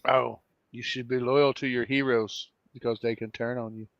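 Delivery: ordinary delivery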